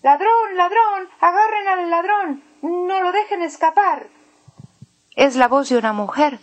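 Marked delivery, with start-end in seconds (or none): 0.0-4.1 high, panicked shouting; woman shouting. Acted. Robbed woman calling for help, high shout. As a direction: cry out for help, high-pitched. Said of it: "Alguien está gritando".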